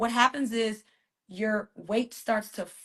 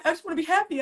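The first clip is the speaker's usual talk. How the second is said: mock whiny griever; 6 semitones higher, faster than usual. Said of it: "That's that".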